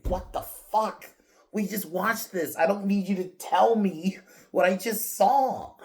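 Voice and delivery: high, incredulous mock outburst; exasperated rant. Reviewer complaining to the film about repeated exposition, exasperated.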